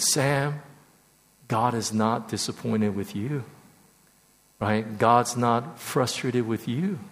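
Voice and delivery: quiet, measured words of comfort; gentle reassurance. Retold or imitated. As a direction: reassure gently, letting each statement settle. Voicing mentor's gentle reassurance.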